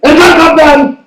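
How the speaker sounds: loud high command; dad shouting. Retold or imitated. Turkish Cypriot dad ordering his son down, shouting. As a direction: shout it up the stairs. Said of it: "they shout at you".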